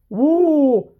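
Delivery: a loud, high whoop of delight; excited whoop. Real, not acted. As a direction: let out a real cheer. Excited whoop about his saws.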